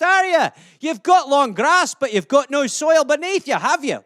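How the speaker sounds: exasperated Scot pressing the point; Scottish accent. Acted. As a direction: rattle off insults as an animated Scot. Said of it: "some Scottish euphemisms for you".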